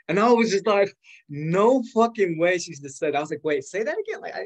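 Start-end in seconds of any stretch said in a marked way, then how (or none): 1.3-2.6 drawn-out, loud and high, slow; no fucking way dragged out in shock; stunned disbelief. Retold or imitated. Retold reaction to free ticket. 3.4-4.2 high-pitched, startled ask for a repeat; wait, say that again in shocked falsetto; incredulous. Retold or imitated. Retold disbelief at ticket news.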